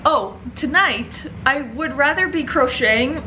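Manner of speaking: ordinary talk, nothing marked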